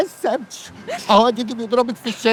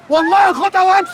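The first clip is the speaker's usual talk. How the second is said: loud insistence; 8 semitones higher, 5 dB louder, faster than usual. Acted. Same words repeated, louder.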